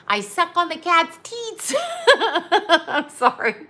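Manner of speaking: can't get it out for laughing. Real, not acted. Recalling a movie gag.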